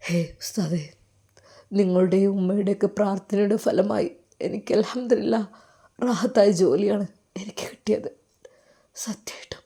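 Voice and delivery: choked with tears, barely voiced; tearful whisper. Student thanking his teacher's prayers, in a tearful whisper. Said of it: "കെട്ടിപ്പിടിച്ച് കരഞ്ഞു".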